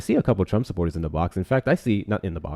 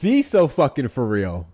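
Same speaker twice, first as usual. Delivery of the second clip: higher, slowed, incredulous reproach; fed up and slightly raised; exasperated disbelief; 4 semitones higher, 4 dB louder than usual. Real, not acted. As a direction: call it out in disbelief. Exasperated reaction to the web page.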